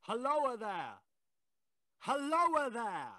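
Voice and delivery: long, high hail down the cutting, drawn out slowly; calling out. Acted. Ghostly voice hailing the signalman from above, a drawn-out high call. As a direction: call out to the man below, slow and high.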